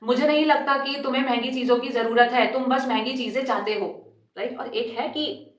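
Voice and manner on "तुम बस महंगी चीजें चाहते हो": ordinary talk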